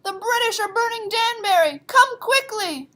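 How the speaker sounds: high loud rider's alarm call. Acted. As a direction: shout to the farmhouses to rouse them. Sybil rides calling the men.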